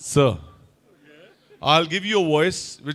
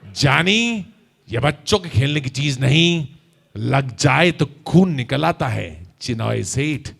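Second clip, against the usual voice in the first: low, slow, deliberate menace; Raaj Kumar dialogue; 5 semitones lower than usual. Shown as a demonstration. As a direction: warn him with a cool filmi threat. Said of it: "आप ऐसे ही बोलिएगा". (धीमी, भारी, धमकी भरी राजकुमार आवाज़)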